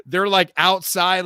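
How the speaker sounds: ordinary delivery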